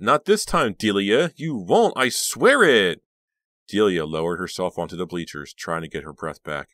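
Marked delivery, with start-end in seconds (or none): 0.0-3.0 high, very loud hysterical vow; sobbing defiance. A narrator's reading. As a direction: wail the vow at a rival. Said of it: "Karina sobbed".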